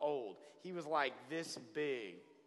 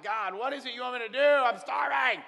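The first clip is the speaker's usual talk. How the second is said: starving plea; 7 semitones higher, 10 dB louder than usual. Retold or imitated. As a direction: beg God at the top of your voice, slowing on starving. Desperate retold plea to God.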